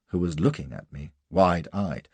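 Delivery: usual speaking voice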